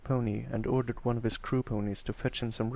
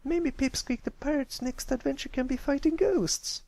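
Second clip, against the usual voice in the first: much higher sweet suggestion; suggesting. Acted. Sweetabelle suggests a new ghost adventure, bright. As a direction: offer the idea brightly. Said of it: "Sweetabelle suggested".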